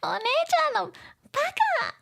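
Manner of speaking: put-on high, fast little-girl outburst; little sister shouting back. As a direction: squeal the insult like a small child. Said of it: "she says it back".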